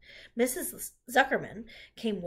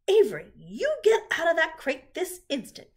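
loud, high scolding order; cross mother raising her voice; commanding mother; 9 semitones higher, 10 dB louder than usual. A narrator's reading. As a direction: order a child out sharply. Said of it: "commanded his mother".

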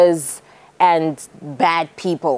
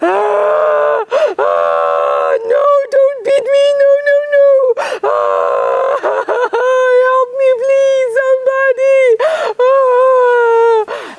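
high shouted cries for help; a beaten traveller shrieking high for help; crying for help; 4 dB louder, slower than usual. A narrator's reading. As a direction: scream for help while being beaten. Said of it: "and he was crying".

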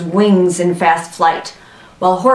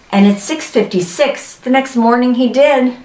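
plain reading voice

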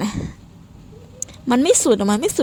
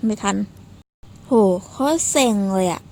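ordinary delivery

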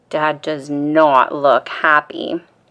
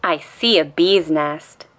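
ordinary delivery

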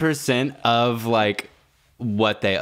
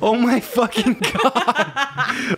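high, slow, laughing wail about a numb leg; 12 semitones higher, much slower than usual. Real, not acted. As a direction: cry it out through helpless laughter, way up high.

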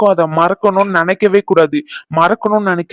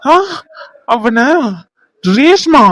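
higher-pitched, stunned questions; shocked disbelief; 6 semitones higher than usual. Acted. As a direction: react in shock, pausing between words. Reacts to news of the attack. (அதிர்ச்சியில் கேட்பது)

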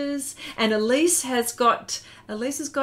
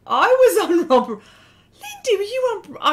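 high, loud, gushing squeal; excited squeal; 9 semitones higher, 8 dB louder than usual. Real, not acted. Telling the chat she was on the show, squealing with excitement. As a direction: burst out in delight at the memory. Excited about old TV memory.

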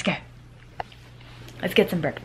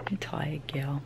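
whisper; 3 semitones lower, 9 dB quieter, slower than usual. Real, not acted. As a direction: murmur it tenderly. Mum whispers to sleepy toddler.

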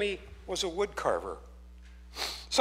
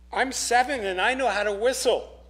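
put-on higher, louder little boy boasting; 6 semitones higher, 5 dB louder than usual. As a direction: pipe it up like a proud seven-year-old. Little boy voiced in story.

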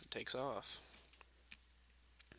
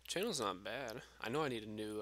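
usual speaking voice

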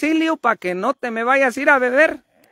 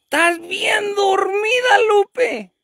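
calling out; 6 semitones higher, slower than usual. Real, not acted. Trying to wake sleeping Lupe.

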